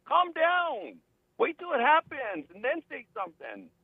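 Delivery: high-pitched, exasperated correction; exasperated rebuttal. Acted. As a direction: talk down the alarmists, voice pitched high. Impatient rebuttal of alarmed people.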